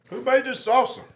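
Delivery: high, startled question about the salsa; surprised. Real, not acted. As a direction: pitch jumps up in surprise at the taste. Surprised by unexpected sweet salsa.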